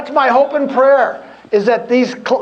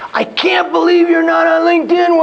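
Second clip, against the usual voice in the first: in-your-face nag; 8 semitones higher than usual. Retold or imitated. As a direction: get in his face about it. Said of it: "he gets in my face and goes".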